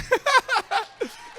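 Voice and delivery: high embarrassed giggle; nervous laugh. Real, not acted. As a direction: laugh off the nerves. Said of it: "I am so nervous".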